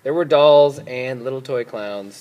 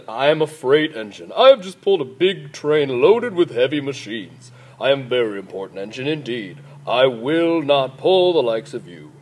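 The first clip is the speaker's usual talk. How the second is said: big, pompous freight engine voice; bellowing engine; 2 semitones lower than usual. A narrator's reading. Freight engine refuses the toys, bellowing pompously. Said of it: "the big, strong engine bellowed".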